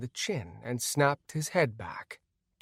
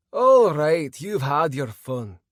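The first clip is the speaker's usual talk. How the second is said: loud, gruff Scottish guard; gruff Scottish accent; 3 semitones higher, 8 dB louder than usual. Acted. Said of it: "came a gruff voice with a Scottish accent".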